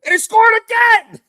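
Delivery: high, loud screams of joy; screaming goal celebration. Real, not acted. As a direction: shriek it like the winning goal just went in.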